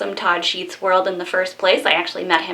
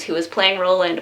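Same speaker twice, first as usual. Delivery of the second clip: ordinary talk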